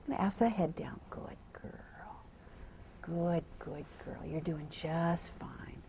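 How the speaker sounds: soft, slow reassuring whisper. Real, not acted. Trainer settling a nervous horse, whispering. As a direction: hush her down gently.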